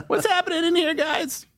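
high squeaky mock alarm; helium voice. Co-host asking the guys what's happening, squeaky helium voice. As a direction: squeak it out high through the laughs. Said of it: "Oh, no, I'm back to normal".

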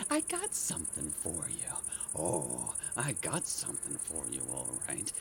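very low, soft threat; hushed and deep, quietly menacing; muttered menace. Acted. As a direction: murmur it darkly to hidden prey. Muttered threat to hidden pests.

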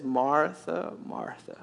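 tender, amused calling of her name; gentle chuckling Jesus. Acted. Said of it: "almost with a chuckle".

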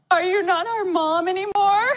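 put-on high, small child's question; imitated worried kids. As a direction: ask like a scared little kid. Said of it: "they were just like".

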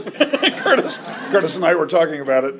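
real laughter breaking through the words; laughing. Real, not acted. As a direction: talk quickly and loudly through a laugh. Laughs while explaining to audience.

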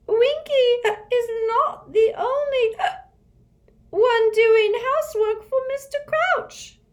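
high, loud, indignant elf; indignant squeak. Acted. Winky snapping at Hermione, indignant squeak. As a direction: squeak it out offended. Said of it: "Winky squeaked indignantly".

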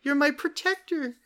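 put-on child kobold gives the dragon a flower, high and sweet. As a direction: offer it up like a trusting little kid. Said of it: "The child offered him a flower".

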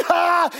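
high loud ha; victory shout. Acted. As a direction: cheer loudly. Israel cheering.

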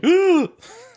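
high loud gasp of horror; a big hammed-up gasp from a parent in the audience; mock shocked gasp. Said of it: "they're like".

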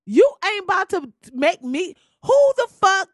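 loud, high outburst of disbelief; indignant shout. Pretend. Refusing to leave the seat, yelling at the crew. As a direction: blow up at the flight crew.

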